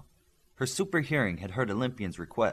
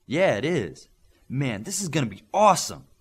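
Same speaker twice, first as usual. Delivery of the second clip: excited; 4 semitones higher, 6 dB louder than usual. Acted. Thrilled about coming training.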